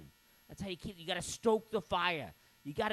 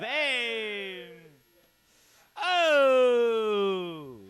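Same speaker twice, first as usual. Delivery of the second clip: loud grating squawk; Gilbert Gottfried squawk; 8 dB louder than usual. Retold or imitated. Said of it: "Gilbert Gottfried does".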